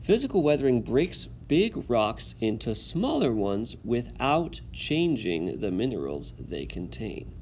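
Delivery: steady classroom read-aloud. Acted. Teacher reads text aloud.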